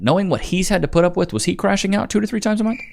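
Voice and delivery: incredulous. Acted. Commentator doubting the wife's claim, high and fast. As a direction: fire it off in disbelief.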